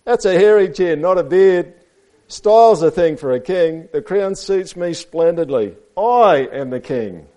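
high, loud storybook boasting; showy read-aloud flamingo; vain flamingo. A narrator's reading. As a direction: proclaim your own splendour to children. Reads Flamingo's speech to children.

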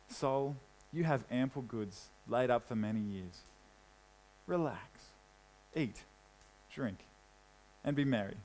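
a man soothing his own soul, hushed and smug; self-satisfied murmur. Acted. Rich man to his own soul, smug and hushed.

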